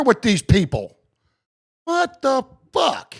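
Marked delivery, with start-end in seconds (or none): 1.8-3.1 slow, higher and incredulous; a real what-the-fuck at the officers; exasperated disbelief. Real, not acted. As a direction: draw it out in disbelief.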